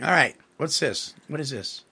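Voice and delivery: ordinary delivery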